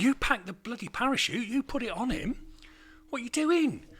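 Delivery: hammed-up outrage at the packer; mock angry yelling. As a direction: lay into him for the bad packing. Mates berating packer as pig falls.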